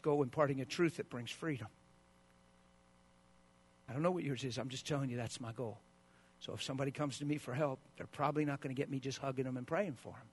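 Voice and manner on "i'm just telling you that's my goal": ordinary delivery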